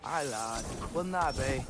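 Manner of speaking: a clumsy go at a Scottish brogue; failed Scottish accent. Retold or imitated. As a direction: attempt a Scottish accent and fumble it. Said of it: "I can't do your accent".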